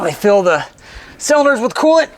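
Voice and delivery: strained high push while lifting; straining under weight. Real, not acted. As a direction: squeeze the words out mid-lift. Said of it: "Sorry, all the grunting and groaning".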